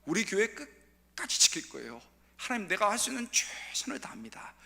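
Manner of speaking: hushed, breathy vow to God; whispered prayer. Pretend. Believer whispering a vow to God, hushed. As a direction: whisper a promise to never give up.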